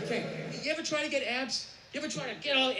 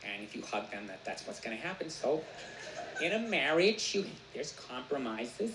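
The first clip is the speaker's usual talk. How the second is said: mother explaining; 7 semitones lower than usual. Retold or imitated. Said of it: "She's like".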